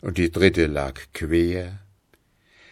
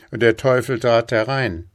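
ordinary delivery, nothing marked

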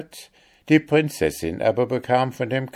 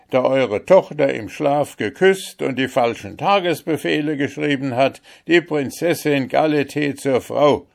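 lightly voiced captain, raised pitch; robber captain, urging advice; 7 semitones higher than usual. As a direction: counsel the emperor with some urgency.

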